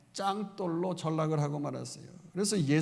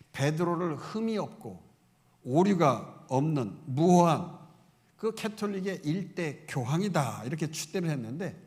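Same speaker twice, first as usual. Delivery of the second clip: normal talking voice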